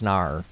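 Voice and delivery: NAR ending on an R; mock Australian NAR; Australian-style no. Shown as a demonstration. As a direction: say NAR in place of NO. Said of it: "It's no longer no, it's nar".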